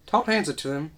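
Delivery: cracking, unsteady voice. Real, not acted. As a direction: voice cracks partway through. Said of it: "My voice cracked".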